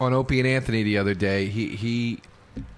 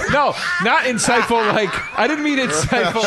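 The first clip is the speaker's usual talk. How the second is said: flustered protest; 9 semitones higher, 3 dB louder than usual. Real, not acted. Protests while others laugh at him.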